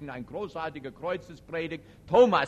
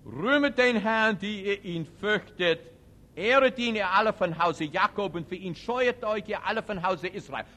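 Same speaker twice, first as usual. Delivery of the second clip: preaching psalm reading; 4 semitones higher, 5 dB louder than usual. Acted. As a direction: proclaim it to the congregation. Reads psalm at full force. (laut verkündete Psalmlesung)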